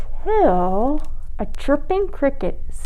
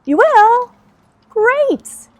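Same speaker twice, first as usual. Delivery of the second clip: delighted surprise; 14 semitones higher, 6 dB louder, much slower than usual. Pretend. Host asks children.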